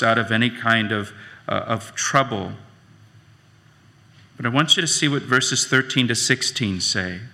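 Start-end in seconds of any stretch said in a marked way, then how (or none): none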